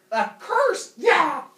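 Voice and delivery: sudden loud high shout; hammed-up yelled reveal; triumphant curse. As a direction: explode out of the hush with a gotcha. Uranus springs the curse.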